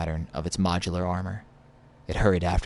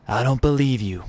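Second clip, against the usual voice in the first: louder, higher sneer; 5 semitones higher, 5 dB louder than usual. Acted. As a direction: spit it out with contempt. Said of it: "he sneered".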